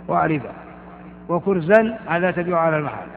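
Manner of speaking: normal talking voice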